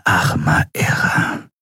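breathless, slow whisper; stunned whisper. A narrator's reading. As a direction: whisper it in shock. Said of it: "flüsterte Shiar entgeistert". (entgeistert geflüstert)

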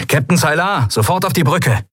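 high-pitched summons into a speaking tube; urgent call. A narrator's reading. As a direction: call the captain up without delay. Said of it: "rief Sephiron in eines der Sprechrohre". (dringender, hoher Ruf)

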